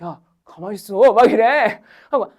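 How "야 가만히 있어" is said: softly, a husband hushing his wife; low-key 'stay out of it'; husband's hushed warning. Retold or imitated. Husband beside her quietly telling her to stay out of it. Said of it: "우리 신랑이 옆에 있다가".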